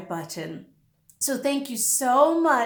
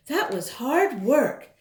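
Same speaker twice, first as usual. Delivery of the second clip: usual speaking voice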